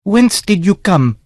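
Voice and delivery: a startled lady challenging a stranger; lady on deck confronting a dripping intruder, demanding; Sancha demanding. Acted. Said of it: "she demanded".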